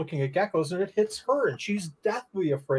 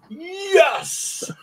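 high squeaky mock "yes"; a thrilled little cheer, pitched way up; hammed-up gleeful yes; 12 semitones higher, 3 dB louder than usual. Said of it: "did you go".

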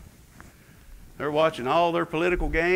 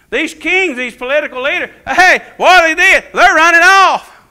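loud, high, driving sermon voice; preaching at full force; 12 semitones higher, 11 dB louder than usual. Acted. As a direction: preach it hard to the congregation. Preaching climax.

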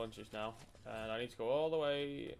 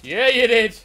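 excited shout; 10 semitones higher, 20 dB louder, slower than usual. Real, not acted. Gloating over friend's game jump.

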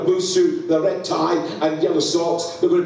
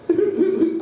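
high mock sob; imitated wailing cry. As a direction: imitate her cry, pitched way up. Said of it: "Every time she went".

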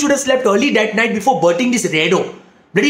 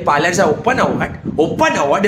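ordinary talk

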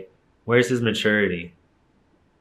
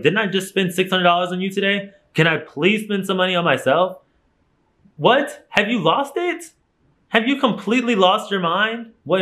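exasperated disbelief; 10 semitones higher than usual. Retold or imitated. Telling boyfriend off over her spending, incredulous much higher voice. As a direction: squeal your disbelief right at him. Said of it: "I'm like".

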